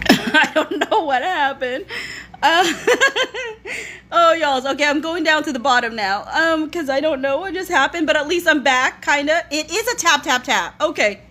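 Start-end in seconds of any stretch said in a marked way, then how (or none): none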